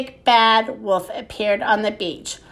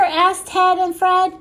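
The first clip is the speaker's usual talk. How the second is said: plain reading voice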